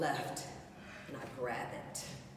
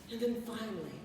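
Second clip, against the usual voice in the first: normal talking voice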